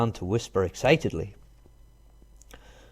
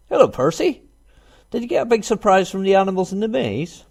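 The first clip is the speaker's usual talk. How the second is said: much higher, chirpy little hedgehog; small hedgehog voice; 9 semitones higher, 4 dB louder than usual. Acted. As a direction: squeak it like a tiny hedgehog. Little hedgehog greets Percy.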